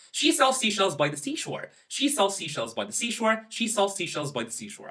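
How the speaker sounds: fast tongue twister demo. Shown as a demonstration. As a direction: rattle it off quickly. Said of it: "three times very fast".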